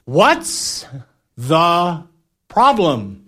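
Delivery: comic call back, word by word; slow, spaced-out mock yell. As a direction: stretch each word as if over a storm. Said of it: "yelling back, something like this".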